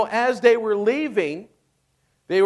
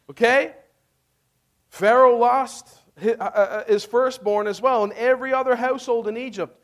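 normal talking voice

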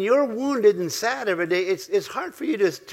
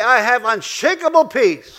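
louder and higher, slowed, punching "unshakable"; rousing call-and-response; 5 semitones higher, 6 dB louder, slower than usual. Shown as a demonstration. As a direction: build it up, strong on "unshakable".

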